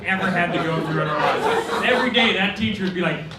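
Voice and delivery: ordinary talk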